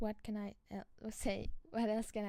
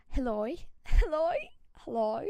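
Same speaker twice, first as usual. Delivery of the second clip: Aussie accent; 4 semitones higher, 8 dB louder than usual. Shown as a demonstration. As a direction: demo of an Australian "hello". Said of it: "for this Aussie accent".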